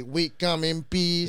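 slow robotic alien greeting; mock alien visitor, slow and robotic; robot accent. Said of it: "Like a robot accent?".

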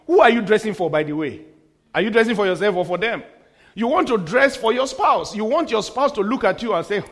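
ordinary talk, nothing marked

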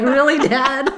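high, loud, laughing disbelief; mock incredulity. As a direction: challenge dad, half amused. Acted incredulous reply to dad.

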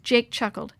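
usual speaking voice

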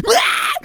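high, loud fake wail; mock crying wail. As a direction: let out a shrill mock cry. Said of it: "[name] starts crying".

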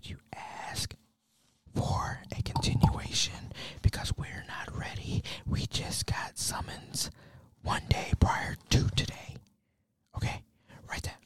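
hushed, unhurried courtroom whisper. Retold or imitated. Son coaching his mom on what to ask in court, whispering. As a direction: whisper careful instructions under your breath. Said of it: "So I'm, I'm just whispering".

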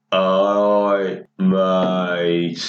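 very low, dragged-out Brummie; imitating a drugged, sluggish Brummie; really slow Birmingham. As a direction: stretch each word out low, as if off your head. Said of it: "I thought Birmingham accent was slow".

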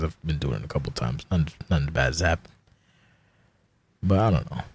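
ordinary talk

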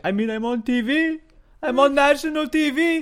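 high, mock-whiny J C voice; imitating J C. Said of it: "he was like".

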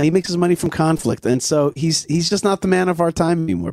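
ordinary talk

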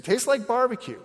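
put-on high little kid voice; light mock toddler.

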